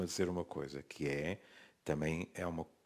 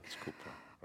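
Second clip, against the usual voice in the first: breathless whispered apology; 5 semitones lower, 15 dB quieter than usual. Real, not acted. Repeated apology under breath.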